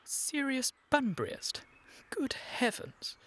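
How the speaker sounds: high, slow, incredulous scoff; scornful disbelief. Acted. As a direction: draw it out in scornful amazement. Scoffs at friend's absurd claim.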